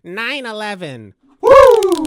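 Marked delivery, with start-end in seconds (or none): 1.4-2.0 very high, loud woo; hammed-up celebratory whoop; mock cheer. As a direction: whoop like a party girl.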